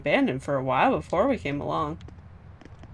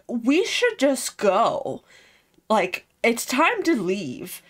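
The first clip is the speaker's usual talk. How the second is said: slow Eisen; 4 semitones higher, slower than usual. Retold or imitated. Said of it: "Eisen's like".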